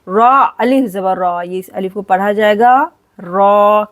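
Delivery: usual speaking voice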